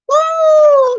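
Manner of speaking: high, loud ghostly woo; spooky ghost woo. Pretend. Describing him appearing like a ghost, spooky woo. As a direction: wail it like a cartoon ghost.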